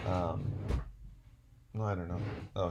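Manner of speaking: normal talking voice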